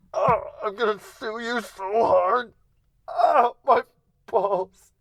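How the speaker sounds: very high, loud squealing cries of pain; squeaky agonized yelling. Retold or imitated. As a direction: squeal in agony like a hurt mouse. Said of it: "I bellowed out in anger and agony".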